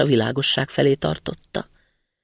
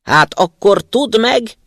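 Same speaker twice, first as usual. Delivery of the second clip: loud, slow, higher roar; roaring spirit; 7 semitones higher, 8 dB louder, slower than usual. A narrator's reading. Angry spirit roars his threat at the student: slow furious roar. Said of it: "üvöltötte a szellem".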